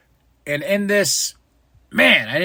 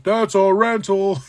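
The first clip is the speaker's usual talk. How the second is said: slow deadpan heckle; mock crowd heckle; heckler quip; 4 semitones higher than usual. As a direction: throw it from the crowd. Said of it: "somebody in the crowd".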